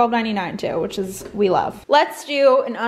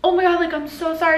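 quick, higher-pitched imitation of an apologetic dog owner; mock apology, pitched up; apologetic neighbor; 6 semitones higher, faster than usual. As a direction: voice a flustered apology, fast and raised. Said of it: "the guy that, like, owns him, whatever, was like".

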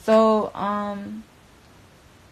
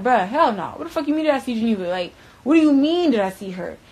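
fast, louder, higher rant of disbelief; incredulous outburst; 4 semitones higher, 6 dB louder than usual. Retold or imitated. Retelling how she snapped back at him on the phone, in incredulous outrage. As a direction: fire it back like the question is insane.

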